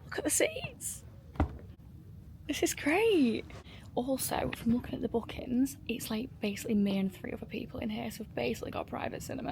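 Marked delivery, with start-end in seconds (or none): 0.1-1.0 breathy hushed whisper; excited cinema whisper. Real, not acted. Hushed in a near-empty cinema. 5.9-9.5 fast excited whisper; giddy whisper in the dark cinema. Real, not acted. Hushed in a near-empty cinema.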